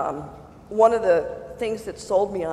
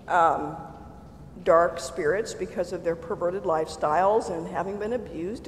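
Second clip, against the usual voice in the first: ordinary talk, nothing marked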